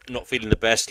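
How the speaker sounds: usual speaking voice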